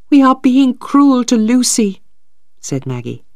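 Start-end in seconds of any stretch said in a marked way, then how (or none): 0.1-2.0 put-on higher, louder, pleading young woman; Maggie's voice. Maggie protests to Stephen, higher and louder.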